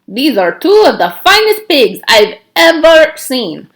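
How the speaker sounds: a hearty father showing off his admiration; proud father declaring. A narrator's reading. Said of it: "Myra's father declared".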